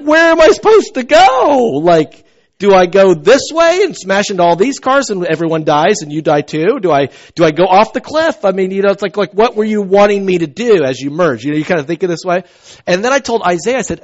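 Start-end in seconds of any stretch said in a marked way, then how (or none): 0.0-8.4 high, loud exasperated yelling at the trucker; exasperated shout. Retold or imitated. Driver yelling at the trucker tailgating him, exasperated shout. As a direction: throw up your hands and yell, way up high. Pastor retells truck riding his bumper.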